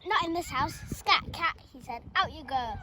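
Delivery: mother driving the cat from her house; stern mother. Acted. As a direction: shoo the cat out sharply.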